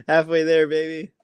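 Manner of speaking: gleeful punchline with a laugh. Real, not acted. Athlete jokes to interviewer about being twenty-five, laughing. Playful callback after joking about age.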